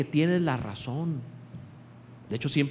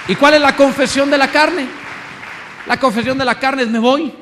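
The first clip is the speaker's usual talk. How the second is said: loud preaching; 8 semitones higher, 9 dB louder than usual. Real, not acted. Preacher shouting the point.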